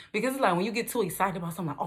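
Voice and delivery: ordinary talk, nothing marked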